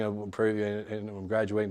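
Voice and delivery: flat, mumbled, bored delivery; bad acting. Shown as a demonstration. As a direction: say it lifeless and quiet. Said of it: "don't just deliver it like this".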